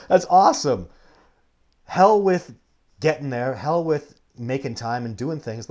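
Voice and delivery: high, loud resigned outburst; loud exclamation. Retold or imitated. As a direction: throw up your hands and give in. Mock resigned giving in.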